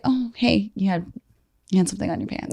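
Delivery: understated flirty tone; soft flirty hint. Shown as a demonstration. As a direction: murmur it sweetly, as a flirt would. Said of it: "it's also the delivery".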